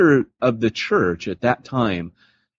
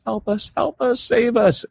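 pleading. Retold or imitated. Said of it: "in the next sentence going".

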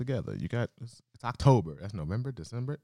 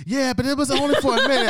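much higher, louder mom voice; high mock mom; 10 dB louder than usual. Mom excusing herself, in a high, loud mock voice. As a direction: play mom brushing off last week. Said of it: "she was like".

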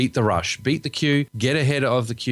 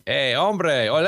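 light mock Mexican accent, pitched higher; 6 semitones higher, slower than usual. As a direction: toss off a playful hint of a Mexican accent. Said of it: "clearly it's no longer going to be going".

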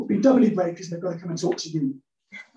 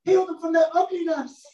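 mock prayer shout; 9 semitones higher than usual. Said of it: "you're shouting out".

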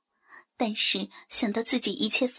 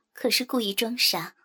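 ordinary delivery, nothing marked

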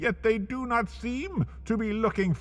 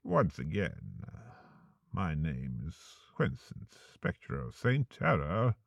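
much lower and softer, hushed host farewell; eerie host signing off, low and quiet; ominous sign-off; 11 semitones lower, 4 dB quieter than usual. Acted. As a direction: release the listener in a low, menacing hush.

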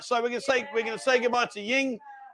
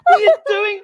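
high, loud shocked laughter; 12 semitones higher, 7 dB louder, slower than usual. Real, not acted. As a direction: cry out in shocked disbelief. Shock at mishap.

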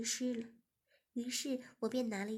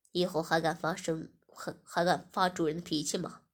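low, louder threatening voice; stern master; 6 semitones lower, 7 dB louder than usual. Acted. Master interrogating Shiya, low and menacing. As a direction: lean in and demand an answer.

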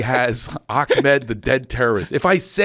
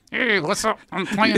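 higher, lips-still dummy voice; 7 semitones higher, 3 dB quieter than usual. Retold or imitated. Dad voicing his kid as a dummy, high and lips still. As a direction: speak like a ventriloquist's dummy. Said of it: "And I go".

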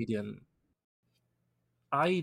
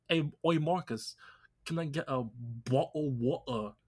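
mock British accent; 2 semitones higher than usual. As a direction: imitate a friend's British "bottle of water". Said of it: "he asks me, like".

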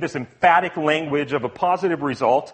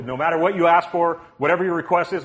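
usual speaking voice

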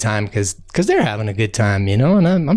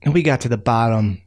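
ordinary delivery, nothing marked

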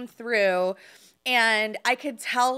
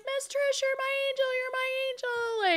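much higher, imitated tearful thanks; tearful gratitude; 3 dB quieter than usual. The pregnant veteran thanking her agent, high tearful voice. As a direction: echo her voice breaking with grateful tears.

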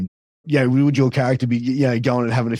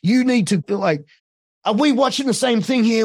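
higher, mock-outraged heel commentator; outraged heel disbelief; 8 semitones higher than usual. Shown as a demonstration. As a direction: play the bad guy's outraged disbelief. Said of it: "That's where you're supposed to go".

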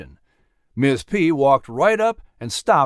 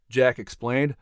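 usual speaking voice

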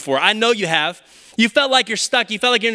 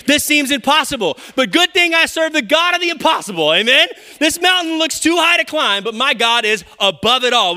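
preaching at full force; 7 semitones higher, 4 dB louder than usual. Acted. Preaching the declaration loudly.

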